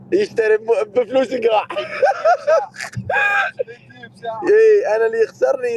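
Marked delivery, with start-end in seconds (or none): 1.7-3.7 very high squealing laugh; shrieking laugh. Real, not acted. Laughs at his own line. (ضحكة صاخبة حادة)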